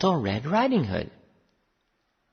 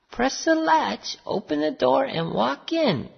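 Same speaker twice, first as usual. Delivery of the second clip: grandmother; 11 semitones higher than usual. A narrator's reading. Grandmother letting in the visitor, high calling voice. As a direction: call out high and measured. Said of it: "said the grandmother".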